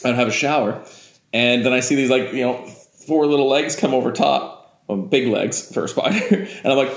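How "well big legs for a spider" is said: ordinary talk, nothing marked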